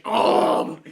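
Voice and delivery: loud breathy yelp of pain; a sharp hurt gasp; mock yelp. Acting a victim chopped up, loud breathy yelp. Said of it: "He's like".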